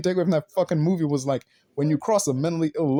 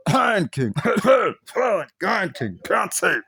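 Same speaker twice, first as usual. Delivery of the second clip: fake cough; 5 semitones higher, much slower than usual. Jab slipped in as fake coughs.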